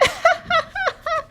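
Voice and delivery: very high cackling bird laugh; bird laughter. Acted. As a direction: cackle like a gleeful little bird. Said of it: "The birds laughed and laughed".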